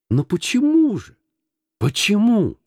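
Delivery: high, exclaimed plea; отчаянное недоумение. A narrator's reading. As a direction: cry out, baffled by the refusal. Nephew pleads after refusal. (высокий недоумённый возглас)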